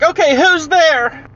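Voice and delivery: high, slow, insistent question; alarmed boy. Retold or imitated. Boy calls out to the closet, high alarmed voice. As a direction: imitate a spooked boy demanding an answer. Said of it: "He's like".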